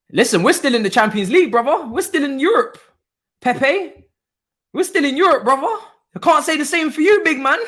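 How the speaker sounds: high, loud teasing brag; gloating taunt. Real, not acted. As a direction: rub it in, grinning. Gloating taunt at Tottenham fan.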